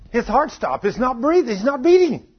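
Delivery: high, rising alarm, retold; urgent paramedic. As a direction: imitate a paramedic calling out an emergency. Paramedic announcing man's heart stopped.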